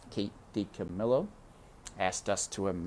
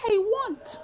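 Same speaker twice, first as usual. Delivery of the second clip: put-on very high, small child's voice; high little girl answering her mother; pleading little girl; 4 dB louder than usual. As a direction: say your name like a lost child. Child Mig.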